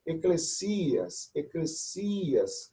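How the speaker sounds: slow, deliberate repetition; slow sounding out. Shown as a demonstration. Teacher repeating Greek word to class, slow. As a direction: draw out each syllable for students. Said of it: "teacher repeating Greek word slowly".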